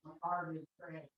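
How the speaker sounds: distant, off-mic voice; speaking from across the room; from another room. Real, not acted. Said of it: "I'm in the other room".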